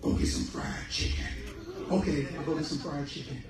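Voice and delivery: whispered order, then a soft giving-in; bossy belly, meek reply; stomach and obedient self. Acted. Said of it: "It used to be".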